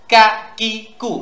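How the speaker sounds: "কা কি কু" short and sharp, each separate; "কা কি কু" with no lengthened vowels; sharp short syllables. Shown as a demonstration. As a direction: say "কা", "কি", "কু" as crisp bursts. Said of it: "যেন আওয়াজটা তীক্ষ্ণ হয়".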